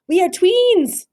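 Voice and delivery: high, loud delighted cry. Acted. Scipito shows their twin faces, delighted. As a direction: announce it with joy.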